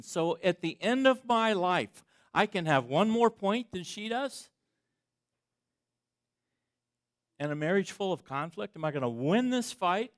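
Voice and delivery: preaching at full force. Acted. Pastor preaching about a marriage fight, at full force. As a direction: preach it out to the congregation.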